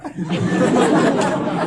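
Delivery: breathy laugh. Real, not acted. Laughs at same story.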